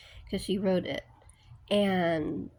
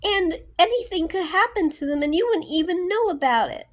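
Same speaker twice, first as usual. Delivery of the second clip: retold rant, higher and louder, building; going off angry; 6 semitones higher, 6 dB louder than usual. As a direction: tear into the boss like he did. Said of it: "he was just going off".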